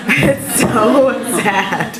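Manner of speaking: laughing through the words, slowed right down; real laugh breaking up the line; amused, giggling it out. Real, not acted. Laughing at the sad ending.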